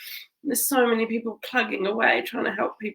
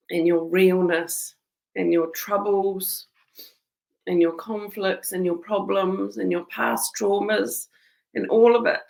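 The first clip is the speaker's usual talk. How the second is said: ordinary talk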